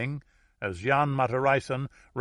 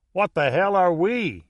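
higher, louder New Orleans outburst; Louisiana yell; 7 semitones higher, 7 dB louder than usual. A narrator's reading. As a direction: yell it as the indignant American. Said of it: "yelled the American from New Orleans".

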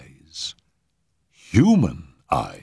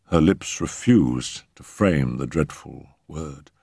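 ordinary delivery, nothing marked

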